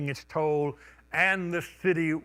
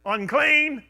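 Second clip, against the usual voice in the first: loud high cry of warning; calling out; 10 semitones higher, 6 dB louder than usual. Retold or imitated. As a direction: call it out to the whole street. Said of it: "had to call out and announce".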